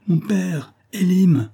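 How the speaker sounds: high-pitched desperate call; crying out. A narrator's reading. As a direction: call out for them while fleeing. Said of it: "elle criait".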